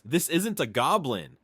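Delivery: ordinary talk, nothing marked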